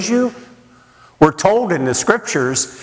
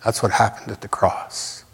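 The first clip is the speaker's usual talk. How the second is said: hushed repeat; 6 semitones lower, 6 dB quieter than usual. Shown as a demonstration. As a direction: lower and much softer, sinking away. Repeats key line, then softer.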